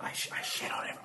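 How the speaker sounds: quick, stammered whisper; acted furtive whisper. Confessing to a puzzled friend at the door, furtive whisper. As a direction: whisper the shameful secret urgently.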